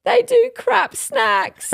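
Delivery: slow, high and loud sobbing wail; tearful. Retold or imitated. Refusing her husband's petrol station idea, sobbing. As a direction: drag it out, blubbering.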